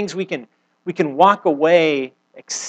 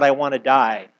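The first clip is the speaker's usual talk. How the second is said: ordinary talk, nothing marked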